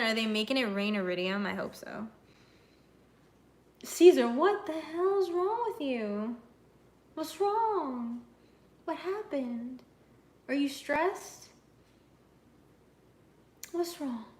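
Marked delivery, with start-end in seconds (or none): 3.8-14.3 high, slow coo at the dog; sing-song pet talk to a shaking dog. Real, not acted. Talking to her dog Caesar.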